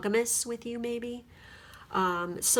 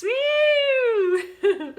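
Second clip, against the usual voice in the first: gleeful woo with laughter; high whoop; 7 dB louder than usual. Real, not acted. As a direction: whoop like a fan cheering. Cheering at a good reading.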